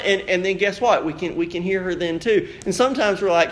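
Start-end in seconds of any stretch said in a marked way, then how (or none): none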